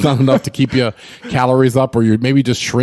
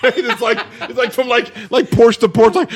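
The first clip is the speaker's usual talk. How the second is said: giggling through the words, voice pitched up; laughing while talking; 7 semitones higher than usual. Real, not acted. Laughing at his own joke.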